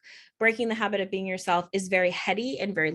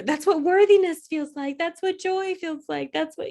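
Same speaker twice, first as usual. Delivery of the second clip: much higher, amazed realization; dramatic elation; 9 semitones higher, 3 dB louder than usual. Retold or imitated. Journaling in her car, dramatic overjoyed discovery. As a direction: marvel at each feeling as it lands. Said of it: "a little bit on the dramatic side".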